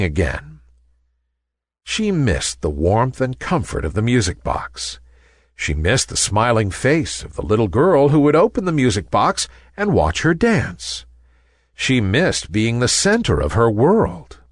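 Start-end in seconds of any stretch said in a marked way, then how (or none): none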